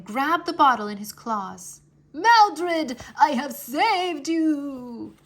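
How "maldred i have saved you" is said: slow, high, loud gloating cry; triumphant cry. Acted. Wizard dragon clutching Maldred's bottle, triumphant loud cry. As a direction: crow over the bottle in triumph. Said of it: "he cried".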